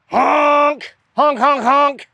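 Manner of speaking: loud high honking like a goose; silly goose imitation, shouted. A gigantic flock of geese?.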